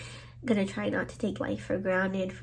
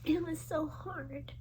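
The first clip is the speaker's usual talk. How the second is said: tearful whisper; 5 semitones higher, 11 dB quieter than usual. Real, not acted. Telling of cat dying in arms.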